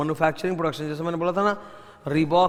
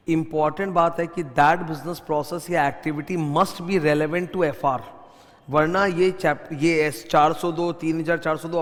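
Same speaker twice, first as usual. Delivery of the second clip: speaking slowly; slower than usual. Shown as a demonstration. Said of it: "मैं थोड़ा धीरे धीरे बोल रहा हूँ".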